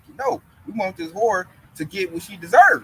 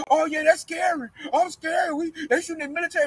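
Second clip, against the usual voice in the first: higher, hammed-up fearful cry; mock scared voice; 7 semitones higher than usual. Mocking what the coworker expected him to say, higher and scared. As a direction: play it like a frightened, jittery coward. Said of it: "He thought I was like".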